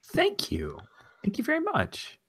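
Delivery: whispery, then very high thank-you; coy falsetto reply, starting in a whisper; small, high, airy character voice; Twi'lek woman. Acted. GM voices Misha replying to compliment.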